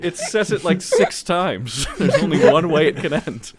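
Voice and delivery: loud, high, talking through laughter; real helpless laughter in the voice; laughing hard. Real, not acted. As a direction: barely get it out for laughing. Still laughing at his own joke.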